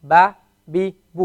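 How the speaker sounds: ba, bi, bu each clipped short; crisp single-beat ba, bi, bu; short vowels. Shown as a demonstration. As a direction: keep ba, bi and bu short, no stretch. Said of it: "We don't stretch the ah sound".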